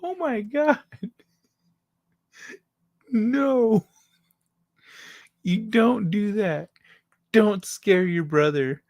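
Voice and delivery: high, drawn-out mock dismay; imitated exasperated parent; scolding parents. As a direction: scold like an appalled parent, barely holding back laughter. Parents scolding, voiced through laughter.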